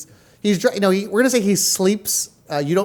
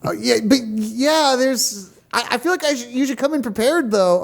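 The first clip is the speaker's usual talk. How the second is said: eager assistant voice; 6 semitones higher than usual. Acted. Playing the cameraman Gregory.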